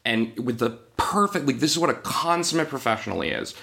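usual speaking voice

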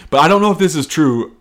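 ordinary delivery

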